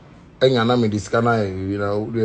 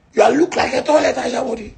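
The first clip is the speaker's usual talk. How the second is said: high taunting; 7 semitones higher than usual. Acted. Taunting, higher take.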